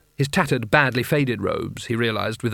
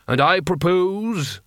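slow, raised ceremonial proclamation; intoning; 5 semitones higher, slower than usual. A narrator's reading. Carding begins his proposal to the wizards, intoning. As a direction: draw it out, building to an announcement. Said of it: "he intoned".

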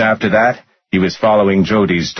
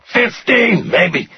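high, strained victim's voice; pained answer; much slower than usual. A narrator's reading. Rudder answering how many times, pained. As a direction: squeeze the guess out high. Tortured man answering under threat.

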